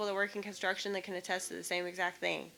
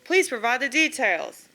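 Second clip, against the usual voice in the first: louder, brisk bureaucratic read of form questions; form read aloud; 2 semitones higher, 7 dB louder than usual. Acted. Vlogger reading each insurance form question aloud, exasperated formal read. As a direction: rattle off the questions with growing exasperation.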